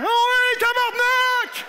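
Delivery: high, loud drawn-out holler; cursing crossing guard. Acted. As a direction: yell it across the street with gusto.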